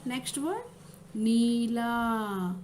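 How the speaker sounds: usual speaking voice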